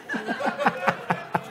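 breathy laugh. Real, not acted. Laugh at the misreading.

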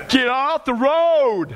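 slow, stretched mock warning; playful drawn-out holler; mock call-out. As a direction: draw it out like calling to drivers. Mock yell at elderly drivers.

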